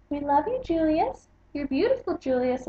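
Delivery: cooing parents. Acted.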